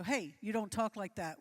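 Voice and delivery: retold firm reprimand, loud on the hey; irritated rebuke. Volunteer guard correcting a guest who swore in church, irritated. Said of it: "It irritated him".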